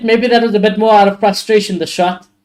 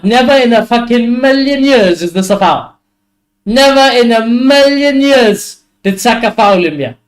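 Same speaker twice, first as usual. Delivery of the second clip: higher, emphatic outrage at the ref; incredulous fan disputing a foul; indignant protest; 4 semitones higher than usual. Real, not acted. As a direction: argue the call, stretching the key words. Protesting referee's decision.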